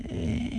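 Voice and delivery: a soft breathy little groan, half swallowed; small photo groan, barely voiced; light groan. Cohost mimicking his photo noise: light groan. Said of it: "Like a light groan".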